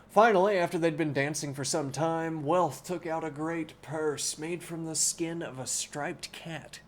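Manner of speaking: clear, steady reading-aloud voice; story narration. Acted. Streamer reading Don Quixote aloud to chat, in narration voice. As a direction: read the book to chat like a storyteller.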